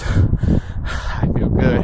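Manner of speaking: whispered, out of breath; breathless whisper. Real, not acted. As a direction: gasp it out after sprinting. Breathless whisper after sprint.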